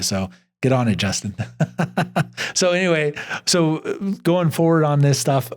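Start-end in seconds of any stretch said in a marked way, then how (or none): 1.4-2.5 light high laugh; chuckle. Real, not acted. After telling Justin to get on it, chuckle.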